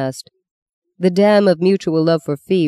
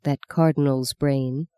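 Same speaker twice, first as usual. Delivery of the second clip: ordinary delivery, nothing marked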